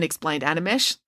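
usual speaking voice

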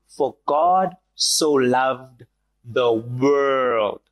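drawn out, weighty scripture read; slow solemn reading. Shown as a demonstration. Preacher to congregation, slow and solemn. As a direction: let each word land slowly. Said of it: "I'm gonna say that one more time".

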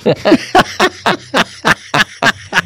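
high, real burst of laughter; punchline laughter. Real, not acted. Joke teller after landing the elevator punchline, laughing hard. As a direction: crack up at your own joke.

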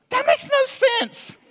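very high, slow squeal of protest; hammed-up baffled outburst; mock disbelief. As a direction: squeak out your exasperation, drawn out. Mock disbelief at crowd's choice.

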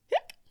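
loud sharp hic; abrupt hiccup. A narrator's reading. Girl answering grandmother, hiccup. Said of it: "Sophia answered".

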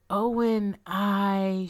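plain reading voice

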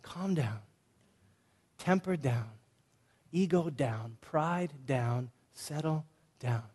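soft, hushed calming words. Shown as a demonstration. Pastor hushing aggressive men, soft and calming. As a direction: gently talk a hothead down.

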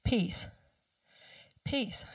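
very soft, breathed mantra. Retold or imitated. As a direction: let the word fall away into silence. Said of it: "he would do that, that mantra".

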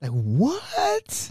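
high, stunned "what?"; acted-out disbelief, voice jumping up; surprised. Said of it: "I was generally surprised at that".

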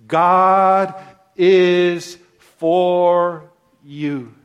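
God, is and for you each struck loud; proclaimed statement. Shown as a demonstration. Preacher proclaiming Paul's statement to the church, loud and slow. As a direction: proclaim it word by word. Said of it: "The statement that he makes is this".

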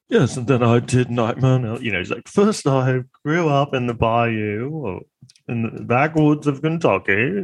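mild mock Jack Sparrow British voice; Johnny Depp impression. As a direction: drift into a half-British lilt. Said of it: "how to do a Johnny Depp impression".

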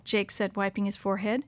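ordinary delivery, nothing marked

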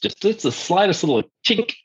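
ordinary delivery

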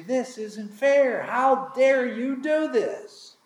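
high, slow mock-indignant whine; indignant complaint. Workers complaining to the vineyard owner, mock indignant. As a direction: play the offended worker.

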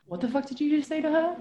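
high, soft mock challenge; retold confrontation. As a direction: get in her face, half-voiced. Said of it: "Like that, right?".

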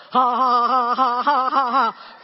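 loud deliberate ha ha ha laughter; joy exercise laugh. Shown as a demonstration. Preacher demonstrates her morning joy exercise with loud laughs. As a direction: push the laughs out loudly on purpose. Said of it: "my joy exercise".

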